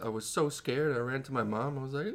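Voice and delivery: usual speaking voice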